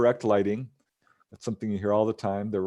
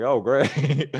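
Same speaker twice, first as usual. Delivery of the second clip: sarcastic dismay; 4 semitones higher, 6 dB louder than usual. Retold or imitated. Dismayed reply to alien.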